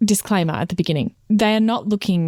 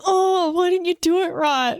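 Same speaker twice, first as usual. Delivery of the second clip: high-pitched mock nagging; playful high whine of complaint; sisterly bickering; 11 semitones higher than usual. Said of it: "sister vibes of the bickering".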